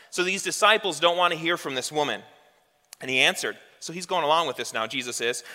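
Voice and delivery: ordinary talk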